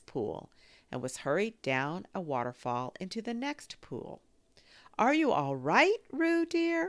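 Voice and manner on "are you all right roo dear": high, loud worried mother's call. A narrator's reading. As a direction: call anxiously across the water. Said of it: "called Kanga".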